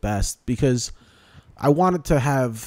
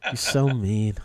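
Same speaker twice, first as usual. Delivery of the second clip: mock offended; 7 semitones higher, 3 dB louder, much slower than usual. Real, not acted.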